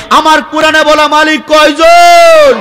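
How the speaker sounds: preacher demanding a loud answer; loud slow call. Shown as a demonstration. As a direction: drag it out loud to the crowd. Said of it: "জোরে বলেন".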